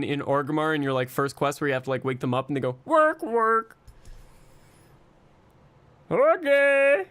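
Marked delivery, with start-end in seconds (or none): 2.9-3.8 higher-pitched, put-on peon voice; lazy peon acknowledging an order; lazy orc peon. Shown as a demonstration. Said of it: "the lazy peon voice". 6.1-7.0 high, loud orc peon greeting; put-on cartoon peon, pitched way up; lazy peon voice. Shown as a demonstration. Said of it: "I was doing that voice".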